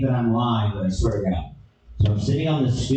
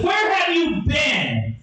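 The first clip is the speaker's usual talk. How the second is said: high, slow shouted demand; mock furious girlfriend; angry girlfriend; slower than usual.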